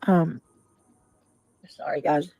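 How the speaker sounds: talking around a lozenge; words round a throat lozenge; lozenge in mouth. Real, not acted. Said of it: "I have a lozenge in my mouth".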